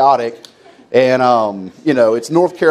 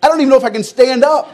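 pained protest; 13 semitones higher, 3 dB louder, faster than usual. Retold or imitated. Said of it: "And I'm like".

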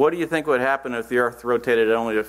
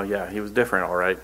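ordinary talk

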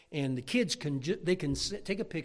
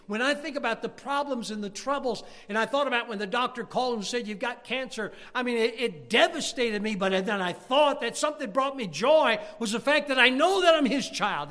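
loud, high, building preacher's call; full-force preaching; 10 semitones higher, 7 dB louder than usual. Acted. Preacher pressing the congregation about salvation, loud and high.

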